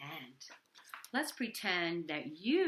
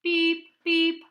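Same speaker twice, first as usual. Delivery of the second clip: high, loud robot beeps; 10 semitones higher, 16 dB louder than usual. Acted. Robots beeping again.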